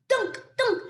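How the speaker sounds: playful high dunk, dunk; bouncy dunk sounds. Acted. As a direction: bounce the dunks up high like cones hitting. Pine cones bouncing off bears.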